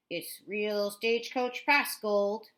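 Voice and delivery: slow, loud hammed-up Southern twang; cheesy Southern accent. A narrator's reading. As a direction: sell it like a corny Southern tour guide. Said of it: "with a cheesy Southern accent".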